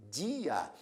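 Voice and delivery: dia drawn out as two syllables, di-a; deliberate two-beat dia; slow, paused pronunciation. Shown as a demonstration. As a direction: separate di from a in dia. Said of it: "Pronuncia pausadamente".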